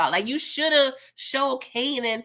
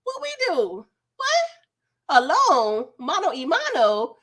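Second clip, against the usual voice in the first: high, squeaky mock confusion; imitating stunned confusion in a high squeak; mock disbelief; 10 semitones higher than usual. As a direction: act out baffled shock, voice shooting up. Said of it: "they looking like".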